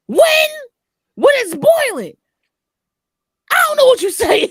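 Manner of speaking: high, loud confused mock shout; baffled mom. Mom shouting back in confusion, high and loud. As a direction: yell it as a lost mom.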